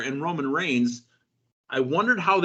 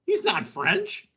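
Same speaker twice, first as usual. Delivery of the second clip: high-pitched, drawn-out disbelief; mock shock; 10 semitones higher, slower than usual. Podcast host breaking news of a wrestler's lost accent, mock shocked. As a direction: play up the scandalized astonishment.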